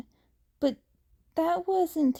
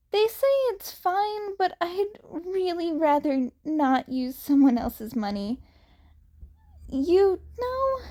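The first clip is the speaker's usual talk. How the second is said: put-on high, rambling teen voice with a nervous laugh; nervous chatty Izuku; 7 semitones higher than usual. A narrator's reading. Izuku chatters to fill the awkward silence, high and nervous.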